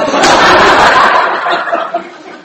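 long loud tongue trill; long rolled trill. Shown as a demonstration. Elder showing the pastor the German R drill, long loud trill. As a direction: roll the R on and on.

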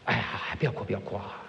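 soft, hushed mother's coaxing; soothing mother. Acted. Mother hushing her crying baby, soft and coaxing. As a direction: hush the baby gently. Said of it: "会说".

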